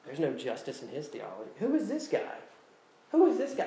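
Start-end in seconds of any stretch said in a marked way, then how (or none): 1.6-2.4 high, scoffing incredulous question; lightly hammed-up disbelief, pitched high; mock indignation. Mocking his own indignant thoughts. 3.1-3.7 high, louder scoffing repeat; hammed-up outrage, high and a bit louder; louder mock indignation. Mocking his own indignant thoughts.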